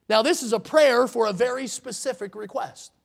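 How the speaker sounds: ordinary delivery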